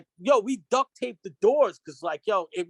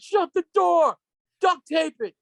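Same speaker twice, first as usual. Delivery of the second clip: high, loud retold shout; re-enacted high-pitched holler; urgent yell; 10 semitones higher, 5 dB louder, slower than usual. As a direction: yell an urgent order as if fleeing. Retelling his panicked escape from smoke.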